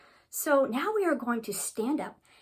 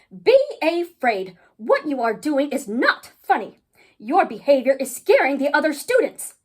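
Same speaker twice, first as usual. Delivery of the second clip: loud, measured reprimand; scolding principal; 4 semitones higher, 13 dB louder than usual. Acted. Principal calling B A Fraid to account, loud and stern. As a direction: tell off a pupil with heavy emphasis.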